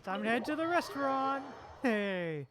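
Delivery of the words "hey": normal talking voice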